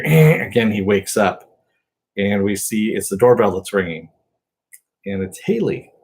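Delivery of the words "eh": imitated buzzer. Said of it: "we hear".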